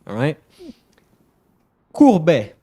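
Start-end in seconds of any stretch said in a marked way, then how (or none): 1.9-2.5 Courbet said loudly, high then falling, French "koor-BEH"; French pronunciation demo. Shown as a demonstration. French streamer teaching listeners ship names, loud clear French pronunciation of Courbet. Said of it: "time for your lesson, all of you".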